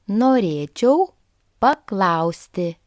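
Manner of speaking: teacher's careful word-by-word "Norėčiau ... paklausti"; slowed practice repeat. Shown as a demonstration. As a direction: split it after "Norėčiau" for learners to follow. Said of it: "let's try a common sentence".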